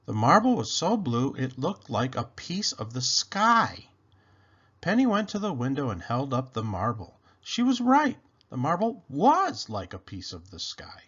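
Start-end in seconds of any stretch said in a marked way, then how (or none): none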